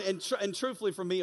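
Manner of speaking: normal talking voice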